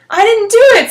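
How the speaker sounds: high, loud protest; yelling. Acted. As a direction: yell your innocence. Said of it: "Smokey yelled".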